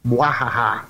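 evil laugh. Acted. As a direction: cackle like a panto villain. Evil laugh after teaser.

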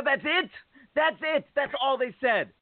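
high, incredulous outburst; can't believe it's that short; outraged disbelief. Real, not acted. Outraged at a canned note.

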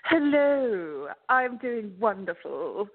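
slow, put-on Bridgerton-style accent. Guest greets the host, getting in the Bridgerton mood, put-on accent. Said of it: "That's not my real accent".

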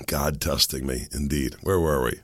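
hoarse and low; croaky worn-out voice; smoker's rasp. Real, not acted. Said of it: "I sound like I've been smoking Joe Camels".

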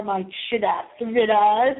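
ordinary talk, nothing marked

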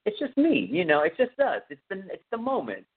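mock innocent guy, pitched high; a lightly hammed-up smooth talker brushing off her fear; high, coaxing, slowing at the end; Tom Savini playing innocent. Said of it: "And Tom was like".

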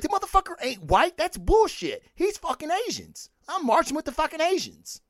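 high-pitched mock indignation, ranting; a hammed-up angry protest, pitched well up; mock outraged rant. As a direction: play it up like an outraged fan ready to march. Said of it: "I'd be like".

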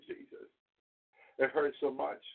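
normal talking voice

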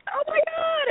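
playful alarmed squeal; stunned oh my God. Retold or imitated. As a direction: squeal it like you just got caught. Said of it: "We're like".

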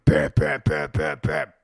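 mimicking roofers hammering overhead; imitated hammering. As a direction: thump each bam like a hammer. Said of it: "just fucking hammering".

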